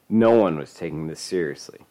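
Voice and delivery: plain reading voice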